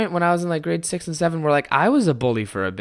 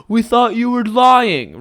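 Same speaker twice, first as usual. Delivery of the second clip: put-on higher, louder and slow kid voice; hammed-up bratty kids; mocking kids; 8 semitones higher, 7 dB louder, slower than usual. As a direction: imitate the careless sixth graders. Kids quoted, slow far voice.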